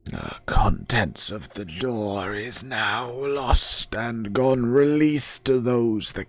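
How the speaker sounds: slow, rasping spirit question; slow undead voice. Acted. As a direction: ask it like a gatekeeping ghost. Door spirit speaks.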